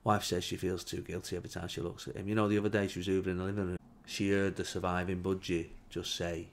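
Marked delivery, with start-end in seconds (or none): none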